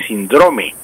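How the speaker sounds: síndrome said as sin-DRO-me; stress on middle syllable. Shown as a demonstration. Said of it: "sin tilde en la I".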